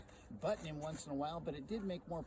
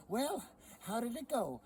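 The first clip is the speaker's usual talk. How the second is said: high, eager wife voice; high wife voice asking how the trading went; 8 semitones higher, 4 dB louder than usual. Acted. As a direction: lean in, keen to hear. Sally asks about his day.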